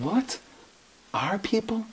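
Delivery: high, quiet, incredulous question; hushed double take, voice jumping up; stunned disbelief. Retold or imitated. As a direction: echo it back softly in high-pitched surprise. Acts out his astonished reply.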